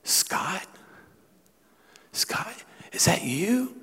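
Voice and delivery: whispered, slow dawning recognition; tentative whisper. Retold or imitated. Father peering at his son, whispering in wonder. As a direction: whisper it haltingly, rising into the question. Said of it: "he went like this".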